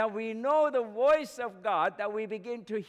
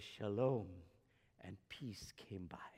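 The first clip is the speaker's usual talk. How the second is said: hushed whisper; 11 semitones lower, 10 dB quieter than usual. Acted. Jesus calming the storm.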